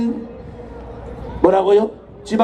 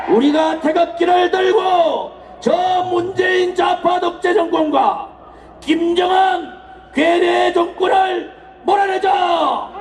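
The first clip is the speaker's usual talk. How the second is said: roaring rallying speech; 4 semitones higher than usual. Acted. Political rally oration.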